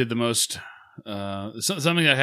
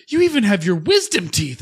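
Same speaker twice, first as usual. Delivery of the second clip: higher mock amazement; amazed dentist; 8 semitones higher, 4 dB louder than usual. Dentist amazed by the wisdom teeth, lightly raised voice. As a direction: play the dentist marveling.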